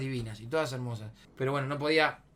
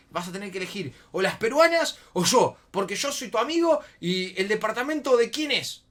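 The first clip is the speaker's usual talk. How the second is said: loud, raised confrontational voice; reproche imitado; 5 semitones higher, 8 dB louder than usual. Retold or imitated. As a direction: give the ultimatum as the offended friend. Ingeniero lo confronta, era un combate. (imitando un ultimátum indignado)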